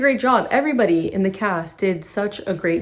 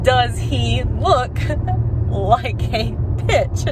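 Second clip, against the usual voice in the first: very slow, higher, drawn-out mock demand; insistent repeat; 4 semitones higher, much slower than usual. Jules asking Brett again after shooting him, slow mock demand. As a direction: stretch out each word, a laugh breaking in.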